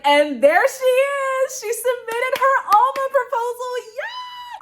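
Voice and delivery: high, loud mock cheer; hammed-up celebration of her arrival; imitating the office cheering her in; cheering colleagues.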